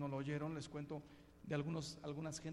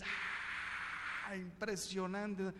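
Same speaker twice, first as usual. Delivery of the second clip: ordinary talk, nothing marked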